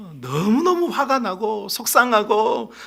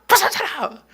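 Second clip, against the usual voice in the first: angry shout; 10 semitones higher, slower than usual. Acted. Slamming door in anger.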